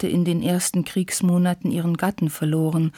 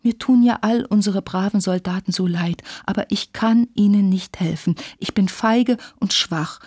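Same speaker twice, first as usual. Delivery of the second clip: ordinary delivery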